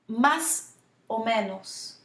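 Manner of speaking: "Más o menos" slowly, with the accent on the A of Más; stress on MÁS. Shown as a demonstration. Teacher modelling for repeat after me, stress on the A of "Más". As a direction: lean on the A in "Más", then "o menos". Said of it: "that accent is on the A".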